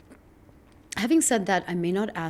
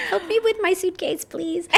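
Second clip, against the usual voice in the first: mock needy request; 12 semitones higher, 4 dB louder than usual. Acting out asking for help.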